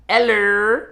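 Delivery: high, loud sing-song hello with a rising end. Retold or imitated. New Orleans lady greeting him, imitated high sing-song hello. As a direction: put on a chirpy Southern lady's greeting.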